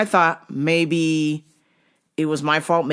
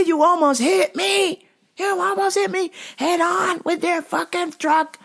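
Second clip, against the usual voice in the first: furious road rage yell; 13 semitones higher than usual. Retold or imitated. Construction guy screaming at the FedEx lady, furious high shout. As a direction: rant and curse at full pitch. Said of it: "this guy was like".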